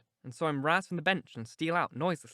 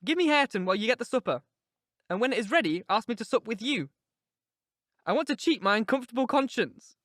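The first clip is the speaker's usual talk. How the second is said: put-on higher, urgent young woman confiding; Catherine, passionate; 7 semitones higher, 4 dB louder than usual. A narrator's reading. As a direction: pour out her heart to Nelly, raised voice. Catherine voiced in reading.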